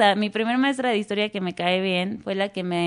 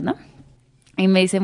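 normal talking voice

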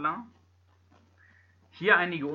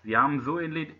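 ordinary talk, nothing marked

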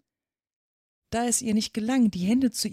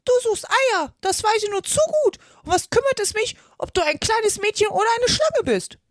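high, loud bird scolding; accusing pigeon; 14 semitones higher, 6 dB louder than usual. A narrator's reading. Pigeon accusing Alice of hunting eggs, scolding. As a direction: shriek the accusation as a cross pigeon.

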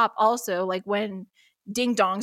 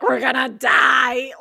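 louder, slow hammed-up wail; mock terror; 2 semitones higher, 7 dB louder, slower than usual. Two sworn enemies sure they'll be shot, hammed-up wail. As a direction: wail it like you're about to die. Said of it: "we're both like".

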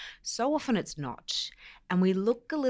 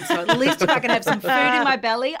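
laughing; 6 semitones higher, 8 dB louder than usual. Real, not acted. Laughing about being a lightweight.

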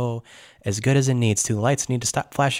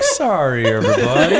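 apology broken up by real laughter; loud, laughing apology; 5 dB louder than usual. Real, not acted. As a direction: say it through laughter. Breaking up while playing a character.

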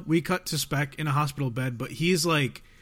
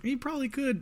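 high, quiet deadpan aside; high sarcastic mutter; dry retort; 10 semitones higher, 5 dB quieter than usual. Retold or imitated. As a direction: toss it off up high. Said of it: "And I was like".

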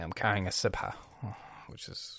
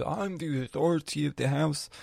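a hint of a stern father, slightly higher; dad's stern decree; 4 semitones higher, 3 dB louder than usual. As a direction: declare "I'm the authority of the house" like a proud head of the house. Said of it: "My dad comes and says".

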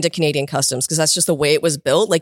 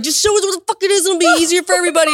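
high-pitched, fast mock yelling; mad officers; 12 semitones higher, faster than usual. Border officers demanding she show them the bag, mad. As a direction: imitate exasperated officers in a high rush. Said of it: "so mad at this point".

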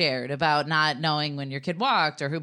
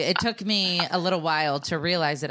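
usual speaking voice

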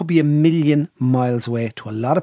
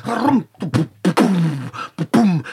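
drum imitation; 4 semitones higher, much slower than usual. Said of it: "He's like".

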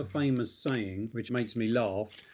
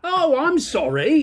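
high, loud mock apology, drawn out; sarcastic apology; 13 semitones higher, 7 dB louder, slower than usual. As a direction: feign apology at an offending driver. Acted sarcastic apology to noisy driver.

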